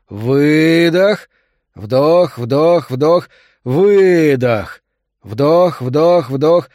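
loud rhythmic coaching, long exhale; breathing drill. A narrator's reading. Doctor drills the labouring woman's breathing, loud rhythmic commands. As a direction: call out each breath forcefully.

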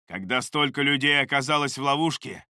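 ordinary delivery, nothing marked

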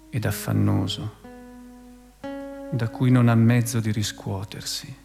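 normal talking voice

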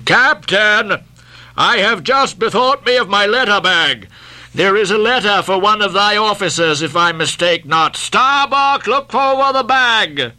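high-pitched captain's voice, calling across; Captain Mayhew, hailing. A narrator's reading. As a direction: speak up high to another ship's captain.

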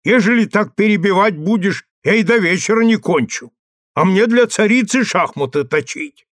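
put-on irritated old husband, voice lifted; crossly exclaiming. A narrator's reading. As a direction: scold your wife with some heat. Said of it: "Марков с сердцем крикнул".

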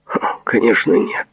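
high, snorted dismissal; scoffing. A narrator's reading. Patient dismisses the question about tasting it, scoffing. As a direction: scoff with a little huff first. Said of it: "хмыкнул Бурк".

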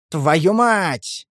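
drawn-out battle yell; yelling curse. A narrator's reading. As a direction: roar it while charging. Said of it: "заорал я".

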